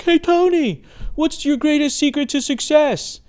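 high, slow, far-off heckler call from across the room; hammed-up shout-out from some idiot in the crowd; mock idiot heckler. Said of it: "some idiot from across the room".

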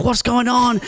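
high, startled mock panic; a half-asleep mother jolted by a late call; panicked mum. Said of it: "She's like".

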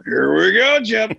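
rising, eager Here we go call; Tony Romo calling to Jim before the play; Tony Romo impression. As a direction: build up the anticipation, lifting at the end. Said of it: "Romo go".

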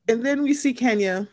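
ordinary delivery

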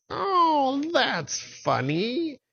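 high cackling witch voice; mocking high witch with cackle. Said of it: "And the witches said".

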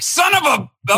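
sudden high mock cuss, cut off; mock outburst. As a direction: bite off a fake curse at the bad news.

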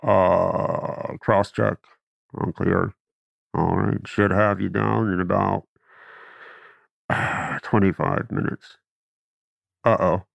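low, easy intercom pilot voice; laid-back captain over the speaker. Retold or imitated. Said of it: "He goes".

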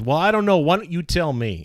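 high-pitched, pointed comeback; challenging boss. Retold or imitated. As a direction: throw the question back, starting loud and high. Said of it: "he just said it just like that".

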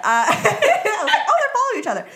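high, loud, laughing quote. Retold or imitated. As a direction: giggle through the quote. Laughing retelling her own thought.

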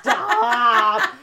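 slow, loud laughing groan. Real, not acted. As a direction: crack up at the joke. Reacting to the punchline.

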